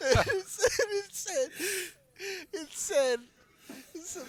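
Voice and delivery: high, wheezing laugh through the words; trying to talk while cracking up. Real, not acted. Can't finish for laughing.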